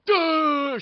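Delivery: high, loud dopey "duh"; re-enacted scared reaction. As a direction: blurt a startled, high-pitched noise. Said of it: "Like, I was like".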